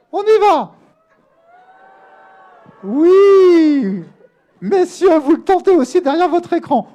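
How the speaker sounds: very high, loud, hooting head voice. Shown as a demonstration. Teacher rallying students to try the inverse, loud head voice. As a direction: fling it up high and loud, holding the vowels. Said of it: "il y a bien sûr l'inverse".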